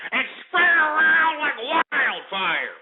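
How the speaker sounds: high, drawn-out showman's call; hyped-up rallying cry. Real, not acted. Host urging listeners to spread the broadcast, hyped call. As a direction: stretch it out high and big.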